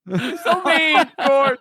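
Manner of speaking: high, laughing complaint; mock hurt. Complaining to George about the teasing, mock hurt. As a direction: play wounded, pitch up and slow.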